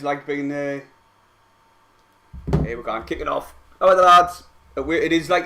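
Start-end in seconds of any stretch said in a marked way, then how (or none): 3.8-4.4 a sudden loud call to the lads; rallying shout to the lads. Real, not acted. Cheering the team to the lads.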